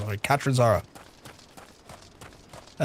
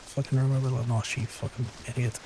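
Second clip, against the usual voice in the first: muttered self-scolding; 4 semitones lower, 8 dB quieter, faster than usual. Real, not acted.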